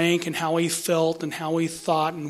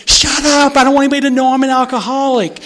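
panicked; 7 semitones higher, 8 dB louder than usual. Retold or imitated. Panicked plea for secrecy.

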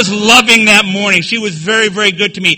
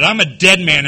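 usual speaking voice

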